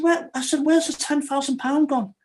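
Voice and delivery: high, pressing question; high-pitched retold confrontation; exasperated demand. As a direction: demand to know where it went. Confronting dad about missing money.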